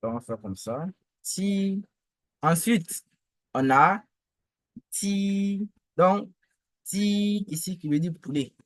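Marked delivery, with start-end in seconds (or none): 1.2-1.8 "Si" high, long and level; sustained, unbending high "Si"; first tone. Shown as a demonstration. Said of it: "le ton constant, le premier ton". 4.9-5.7 "ti" high, long and level; sustained, unbending high "ti"; first tone. Shown as a demonstration. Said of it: "le ton constant, le premier ton". 6.8-7.4 "ti" high, long and level; sustained, unbending high "ti"; first tone. Shown as a demonstration. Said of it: "le ton constant, le premier ton".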